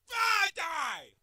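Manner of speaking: high, loud yell falling away; real gamer outburst; frustrated shout. Real, not acted. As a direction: yell it at the enemy. Yelling at the game.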